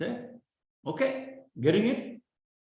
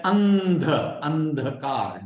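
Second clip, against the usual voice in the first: slow drill; slower than usual. Shown as a demonstration. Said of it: "You must say these words".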